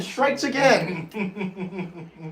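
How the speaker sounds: slow, drawn-out laughing delivery. Real, not acted. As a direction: savor it through a laugh. Celebrating the winning catch.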